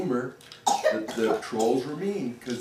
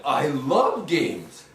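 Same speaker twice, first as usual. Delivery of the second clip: higher, delighted ghost; eager ghost; 8 semitones higher, 3 dB louder than usual. Acted. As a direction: gush about games. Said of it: "And the ghost said".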